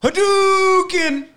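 game move shout. Pretend.

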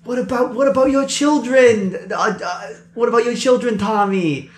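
high, urgent pleading guard. Acted. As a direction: plead about the kids. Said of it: "he's like".

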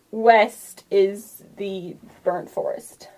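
ordinary talk, nothing marked

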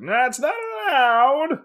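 put-on very high, slow old kung fu master; Shaolin master voice. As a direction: squeak it out slowly like a Shaolin master.

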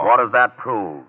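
slow, loud, high challenge; defiant. Acted. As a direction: throw it back defiantly. Villain challenged by agent.

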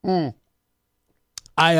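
usual speaking voice

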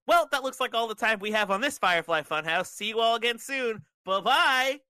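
high, loud, bubbly sign-off; chipper. A narrator's reading. Bray Wyatt signing off the Firefly Funhouse, chipper. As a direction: snap into cheery kids'-show host, climbing to the goodbye. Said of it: "He snaps back to his chipper self".

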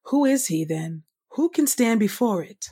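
ordinary talk

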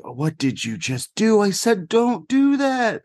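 retold outcry climbing very high, last words drawn out; appalled scolding. Neighbour scolding mom after she pulled, appalled and high. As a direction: imitate horrified exasperation, rising to a stretched high pitch.

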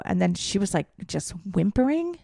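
ordinary talk

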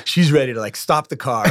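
ordinary talk, nothing marked

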